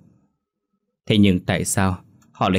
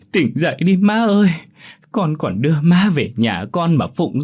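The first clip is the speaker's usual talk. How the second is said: fake wailing; 10 semitones higher, 5 dB louder than usual. A narrator's reading. Uncle's family kneeling at grandmother's coffin, hammed-up loud wailing. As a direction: ham up the grief, kneeling at the coffin. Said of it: "quỳ gối trước quan tài ngoại mà gào khóc".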